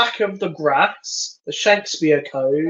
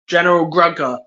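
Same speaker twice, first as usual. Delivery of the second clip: usual speaking voice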